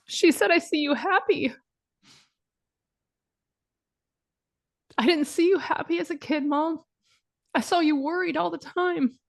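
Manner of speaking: holding back tears while quoting her daughter; getting emotional. Real, not acted. Mom retelling her daughter's words to listeners, fighting tears. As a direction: voice rising and catching as tears come. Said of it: "I'm gonna get emotional".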